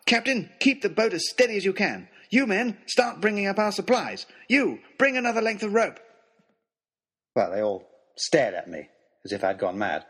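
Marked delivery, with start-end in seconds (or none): 0.1-6.0 high, urgent commands; shouted orders. A narrator's reading. Narrator ordering captain and crew about, urgent shouting. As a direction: call out orders across a deck. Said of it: "I shouted".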